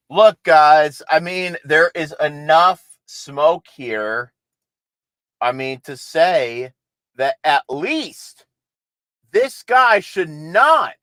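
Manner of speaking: slow, loud, every word weighed; emphatic slow point. Real, not acted. Host to viewers, slow and emphatic. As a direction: hammer home that he should not be nominee.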